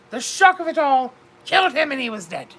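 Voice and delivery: ordinary delivery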